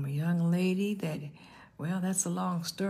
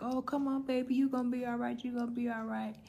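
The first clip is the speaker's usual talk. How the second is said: imitated soothing grandmother; 7 semitones higher, faster than usual. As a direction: coo reassurance in a raised, softened pitch. Grandmother voiced soothing screaming newborn.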